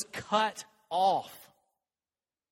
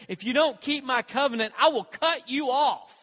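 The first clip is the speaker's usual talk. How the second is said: higher, louder, slowing to a weighty close; stern divine warning; 5 semitones higher, 5 dB louder than usual. Retold or imitated. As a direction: pronounce it as God's solemn threat. Voicing God's covenant warning.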